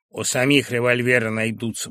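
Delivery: ordinary delivery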